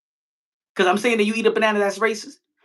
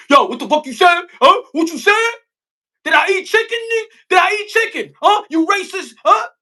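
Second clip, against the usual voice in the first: 1 mock angry confrontation; 10 semitones higher, 5 dB louder than usual. Acting out an offended guy.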